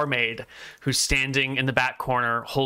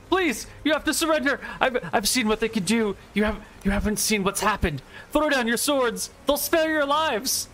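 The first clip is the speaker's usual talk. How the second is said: high, urgent begging voice; desperate pleading monk; 10 semitones higher than usual. Acted. Monk begging his knights to surrender, desperately. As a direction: plead fast and high, drawing out the last line.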